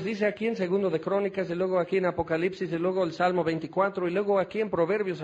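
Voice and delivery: fast list of Bible references; rattling off verses. Retold or imitated. Preacher mimicking his long answer, fast rattled list. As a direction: race through the citations like a know-it-all. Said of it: "Te voy a decir".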